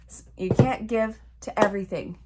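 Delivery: ordinary talk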